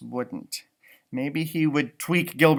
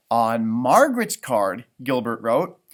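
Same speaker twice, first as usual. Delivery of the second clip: usual speaking voice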